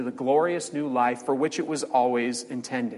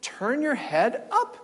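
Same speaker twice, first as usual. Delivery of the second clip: patient creature voice; 7 semitones higher than usual. Retold or imitated. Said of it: "the three-dimensional creature said".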